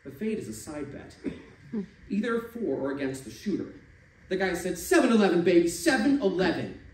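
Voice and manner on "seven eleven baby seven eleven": loud, high chant at the dice; calling the dice. Acted. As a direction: urge the dice on, full voice. Shooter calls on the dice.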